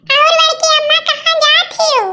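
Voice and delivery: put-on high, childlike greeting; a young niece politely greeting her aunt; little girl voice.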